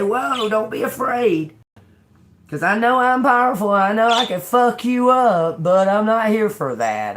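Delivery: a hint of a mighty angel calming a frightened human. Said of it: "they say".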